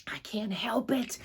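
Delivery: hushed whisper; boy whispering to the librarian; whispered reply. Acted. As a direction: whisper it back, still urgent. Boy answers the shush.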